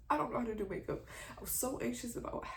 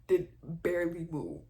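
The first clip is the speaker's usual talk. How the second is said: ordinary delivery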